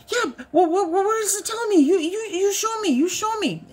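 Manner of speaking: high, stammering demand; eager husband. Retold or imitated. Wife imitates husband demanding to be shown, high stammering. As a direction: badger her to show you.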